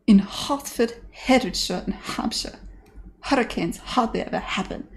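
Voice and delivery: dropped-H Cockney: "Hertford" as 'ertford, "hurricanes" as 'urricanes; lightly put-on Cockney with dropped h's; Cockney elocution line. Streamer quoting Eliza's first Cockney attempt. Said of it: "quote Eliza Doolittle".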